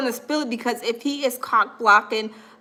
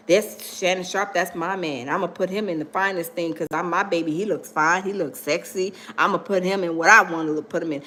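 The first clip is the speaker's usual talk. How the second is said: low, soft swooning stylist voice; low doting lover's murmur; 9 semitones lower, 5 dB quieter than usual. Retold or imitated. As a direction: purr it like he's smitten with his man. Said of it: "he's like".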